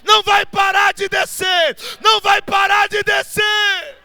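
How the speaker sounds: fiery shouted sermon, high and loud; preaching at full force. Acted. Pregação em alta voz. (pregação inflamada, gritando)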